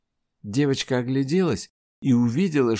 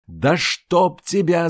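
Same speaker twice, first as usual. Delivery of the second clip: loud, slow furious yell; 7 semitones higher, 5 dB louder, slower than usual. Acted. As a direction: yell it out in pain and rage. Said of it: "завопила Айжар". (громкий крик)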